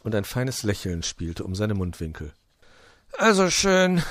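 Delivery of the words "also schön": high, loud, exasperated surrender; giving in. A narrator's reading. Fox giving in to Puh, high and resigned. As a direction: concede defeat with emphasis. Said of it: "gab sich der Fuchs geschlagen".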